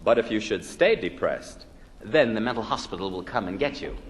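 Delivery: ordinary delivery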